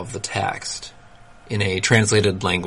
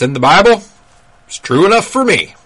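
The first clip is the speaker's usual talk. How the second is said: hammed-up Southern believer voice, pitched high; weird Southern accent; 10 semitones higher, 5 dB louder than usual. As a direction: put on a folksy Southern accent to poke fun. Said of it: "I don't know why he has kind of a weird Southern accent".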